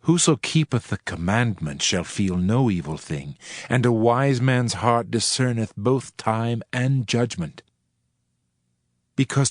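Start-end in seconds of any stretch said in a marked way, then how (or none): none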